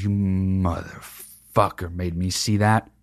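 low, slow muttered resentment; mock grudge. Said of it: "'cause I was like".

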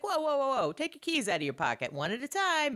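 high, fussy mock security guy; mock guard. Host mimicking airport security, high and fussy.

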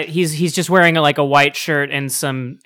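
ordinary delivery

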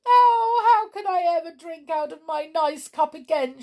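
high, loud storybook wail; weeping. A narrator's reading. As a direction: wail it like an upset doll. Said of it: "she wept".

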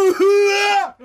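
loud, high "uwa!" yell; mock-shocked scream. Lawyer reacting to the defendant's excuse, loud mock-shocked scream. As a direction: cry out in exaggerated outrage.

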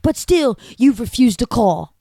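hammed-up British accent, mock-haughty. Quoting a character to her co-host, in a jokey British accent. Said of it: "That's my British accent".

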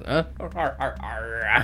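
repeated pirate "arr" cackle; hammed-up seafarer's "ar-ar-ar"; Mr. Krabs-like pirate laugh. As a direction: bark out a cartoon sea-captain laugh. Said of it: "He's fucking Mister Krabs".

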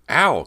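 loud pained shriek. A narrator's reading. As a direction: yelp as the claw scratches. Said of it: "Cody shrieked".

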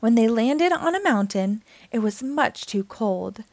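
ordinary delivery, nothing marked

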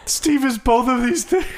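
high, tearful pretend sobbing; mock crying. Host reading criticism of his own voice, mock crying. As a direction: sob it out as if wounded. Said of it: "crying on the mic".

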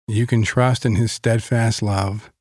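ordinary delivery

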